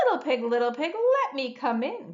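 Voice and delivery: a hint of a coaxing wolf; mild wolf cry; lightly voiced wolf. A narrator's reading. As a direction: call at the door, a touch wolfish. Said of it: "cried the wolf".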